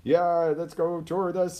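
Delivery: high, loud, pumped-up salesman cheer; excited. Shown as a demonstration. Coach showing viewers a motivated person, high and loud excitement. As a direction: hype the crowd like an eager car salesman. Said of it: "somebody who's motivated, really excited".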